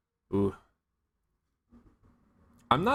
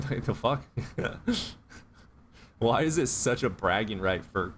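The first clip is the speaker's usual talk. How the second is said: laughing. Real, not acted. Laughing at macho bragging.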